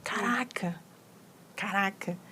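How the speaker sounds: a hushed "Caraca" in wonder; awed whisper. Real, not acted. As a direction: whisper it, amazed. Awed reaction after the verse.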